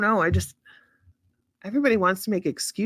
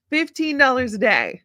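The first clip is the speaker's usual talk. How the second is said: fifteen punched, higher and louder in disbelief; shocked at the cost; 5 semitones higher, 4 dB louder than usual. Real, not acted. To John on quitting cigarettes, shocked Fifteen dollars a day. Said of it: "See? That's crazy".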